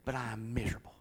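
hushed whisper. Retold or imitated. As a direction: breathe the words out. Quoting the man's sad confession.

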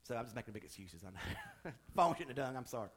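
ordinary talk

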